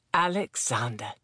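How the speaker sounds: low, musing single word; pondering a name, pitched low; testing the name. A narrator's reading. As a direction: try the name out on your tongue. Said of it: "He tested the name on his tongue".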